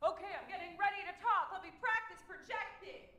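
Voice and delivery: very high, fast hammed-up voice; mock eager speaker. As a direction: parody someone warming up to talk. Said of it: "People go".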